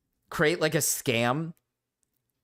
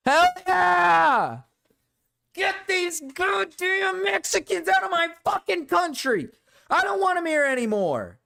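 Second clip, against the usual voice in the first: hammed-up shouting, pitched way up; loud mock rant; 4 dB louder than usual. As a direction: yell it high like an over-the-top ranter. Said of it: "said that as loud as I did".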